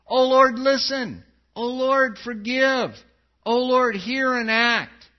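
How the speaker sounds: desperate calling prayer; pleading cry. Acted. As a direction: cry out to the Lord. Crying out Daniel's plea.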